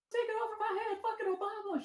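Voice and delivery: imitating a frantic neighbor; hammed-up high screech; mock scream. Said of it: "She's screaming".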